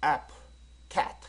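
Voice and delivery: apple and cat as short, separate words; isolated apple, then cat, each briskly stopped; short vowel. Shown as a demonstration. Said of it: "짧게 발음하니까".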